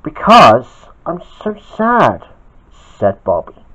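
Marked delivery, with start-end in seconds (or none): 0.0-2.3 slow, higher-pitched lament; storybook sadness, lightly acted; mildly sad. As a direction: drag out the words mournfully. Bobby tells Peach he is sad.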